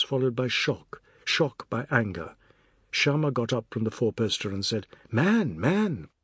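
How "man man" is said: higher, swooping appeal; dismayed wifely protest; pleading. A narrator's reading. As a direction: plead with an angry husband to stop. Wife pleading with angry husband.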